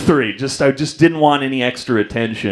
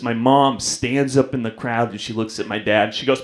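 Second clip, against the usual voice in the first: normal talking voice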